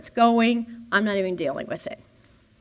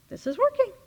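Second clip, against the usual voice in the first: high, airy, dreamy voice; blissed-out relief; 13 semitones higher, faster than usual. Retold or imitated. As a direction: float the words up happily. Relaxed driver, content again.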